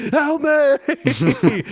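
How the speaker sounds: loud high cry for help; screaming. Pretend. Acting out a scream.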